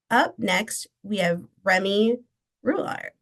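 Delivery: normal talking voice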